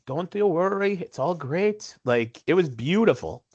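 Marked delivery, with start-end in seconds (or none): 0.0-2.0 put-on calming Indian accent. Indian seatmate calming the nervous flyer, light Indian accent. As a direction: soothe him like the calm Indian woman. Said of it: "the most calming Indian woman".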